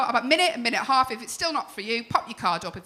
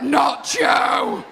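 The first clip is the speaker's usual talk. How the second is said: mock shout. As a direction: yell "Not Joe" like heckling fans.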